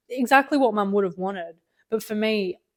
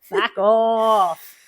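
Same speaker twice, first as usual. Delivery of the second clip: loud laughing Australian "Fuck off"; Australian accent; 3 semitones higher, 10 dB louder than usual. Pretend. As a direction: shout "Fuck off" in a broad Aussie accent. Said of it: "I have to say it in Australian accent".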